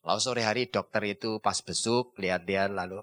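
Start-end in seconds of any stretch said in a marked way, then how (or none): none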